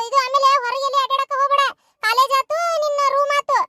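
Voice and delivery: high-pitched, insistent grandma voice; high, steady nagging phone voice; fussing grandmother on phone. Acted. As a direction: warn a granddaughter anxiously down the line.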